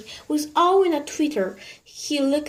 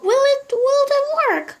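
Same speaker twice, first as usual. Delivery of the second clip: much higher, eager lady's voice; high anxious lady; 7 dB louder than usual. A narrator's reading. Mrs Silver hopefully asks Mr Hoppy, high lady's voice.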